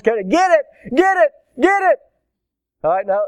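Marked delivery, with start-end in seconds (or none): 0.3-2.0 high, loud, urgent yelps, climbing each time; mock frantic screaming. Onlooker urges man with shovel to kill snake, frantic. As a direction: imitate a panicked bystander egging someone on. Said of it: "Missus Hodges screamed".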